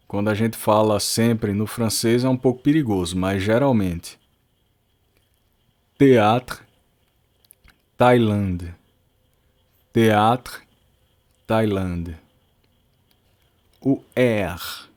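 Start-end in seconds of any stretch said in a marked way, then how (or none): 6.0-12.2 Théâtre said te-atr and Thaïlande said tai-land, plain t; French th as t. Shown as a demonstration. Teacher showing students th sounds like t, French pronunciation. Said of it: "sempre vai ter o som de T".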